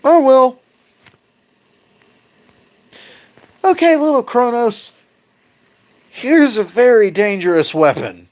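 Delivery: high, singsong mom voice; mother's voice. Retold or imitated. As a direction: hand over the weapon like a sweet scheming mom. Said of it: "Absolute youngest is like".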